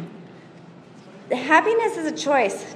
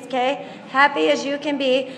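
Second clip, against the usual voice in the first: usual speaking voice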